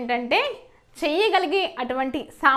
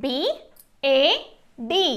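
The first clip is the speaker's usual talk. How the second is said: very slow, letter by letter; spelling out; much slower than usual. Shown as a demonstration. Teacher spelling a word for learners, slowly letter by letter. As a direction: spell it with long gaps between letters. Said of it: "ఎలా పలుకుతుందో చూద్దాము".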